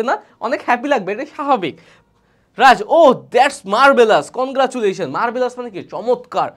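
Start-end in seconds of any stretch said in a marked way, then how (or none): 2.6-5.1 loud, drawn-out admiring exclamation; acted congratulations. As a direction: congratulate a friend with hearty emphasis. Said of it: "এইরকম আমরা expression দিয়ে থাকি".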